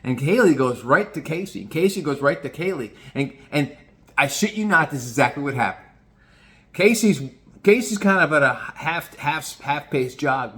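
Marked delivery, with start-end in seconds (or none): none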